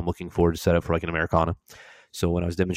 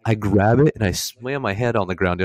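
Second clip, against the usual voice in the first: normal talking voice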